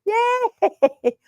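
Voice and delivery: high squealed cheer. Real, not acted. Owner cheering her puppy's house-training progress, high excited squeal. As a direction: celebrate the puppy's win.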